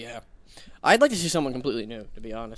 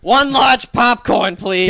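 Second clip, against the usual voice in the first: much louder drawn out order; loud slow order; 5 semitones higher, 9 dB louder, much slower than usual. Pretend. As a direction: stretch out the order loudly. Mock order.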